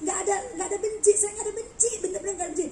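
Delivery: light, bantering denial; retold joking reassurance. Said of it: "Saya sambil bercanda".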